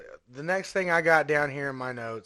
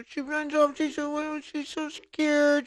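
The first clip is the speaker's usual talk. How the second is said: high, whiny mock fear; pitched-up teasing scared voice; 11 semitones higher than usual. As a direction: squeak it like a frightened girl. Mimicking the scared girl.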